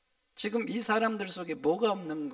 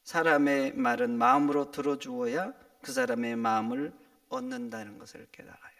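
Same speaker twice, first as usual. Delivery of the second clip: ordinary talk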